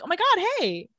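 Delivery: high-pitched imitation of a gushing greeting; sudden friendliness. Girl greeting Damon after he compels her, sudden mock delight.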